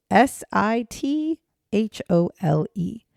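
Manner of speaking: spelling a name for the audience, slow and careful. Shown as a demonstration. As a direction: spell each letter slowly and clearly. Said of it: "spell out his name for you".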